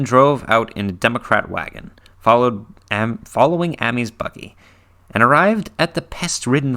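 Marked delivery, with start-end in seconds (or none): none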